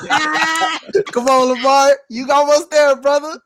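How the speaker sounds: high, loud laughing cheer. Real, not acted. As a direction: laugh while cheering him on. Laughing at tech trouble.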